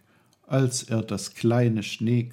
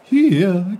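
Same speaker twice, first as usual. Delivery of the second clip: high, loud playful call; a giggling stream teasing; giggling brook; 10 semitones higher, 11 dB louder than usual. Acted. The brook giggles at Peter looking around. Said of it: "kicherte der Bach".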